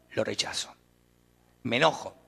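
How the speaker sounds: whispered rejection. Retold or imitated. Voicing rejection of God.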